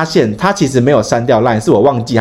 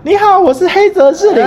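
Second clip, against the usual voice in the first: put-on baby voice; 4 dB louder than usual. Friend imitating his mate's cutesy phone greeting in baby voice. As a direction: mimic a sugary baby-doll voice. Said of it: "他都会学娃娃音说".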